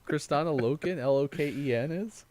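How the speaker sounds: high and loud through laughter; can barely get it out for laughing. Real, not acted. Cracking up with his co-host over a birthday list, laughing.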